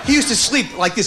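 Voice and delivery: ordinary talk, nothing marked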